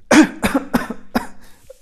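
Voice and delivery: sudden choking cough; coughing fit. Real, not acted. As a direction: cough as saliva goes down wrong. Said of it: "Ah, perdón".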